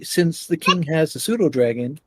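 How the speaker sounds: ordinary delivery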